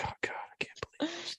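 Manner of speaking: whispered aside to oneself, imitated; despairing whisper. Dad steps back from his press statement, despairing whisper. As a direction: step back and whisper it under your breath.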